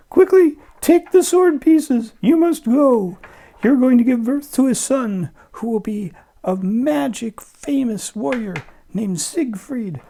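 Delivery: high, loud urgent warning; high urgent female voice; high mother voice. Acted. Brunhilde's mother voiced in story.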